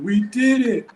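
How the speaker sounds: high, loud mock victory shout; imitated celebratory yell. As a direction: yell it like an overjoyed young coach. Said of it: "just start yelling".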